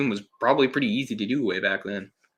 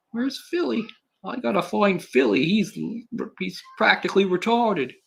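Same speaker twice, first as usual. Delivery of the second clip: put-on much higher, whiny street kid; mock Tony voice; 10 semitones higher than usual. Reviewer mimics Tony looking for Philly, much higher and whiny. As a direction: squeak it out like a frantic kid.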